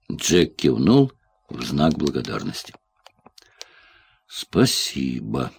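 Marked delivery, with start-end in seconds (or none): 4.3-5.5 low, slow, soft and bewildered; slow, low-voiced, baffled thank-you; puzzled murmured thanks. A narrator's reading. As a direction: let it drop quietly, puzzled. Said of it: "проронил он, придя в ещё большее недоумение".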